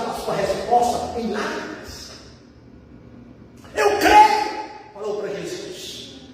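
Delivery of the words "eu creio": high, loud cry of faith; imitated exclamation. Father declaring belief to Jesus, imitated exclamation. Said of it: "Ele, ele exclamou".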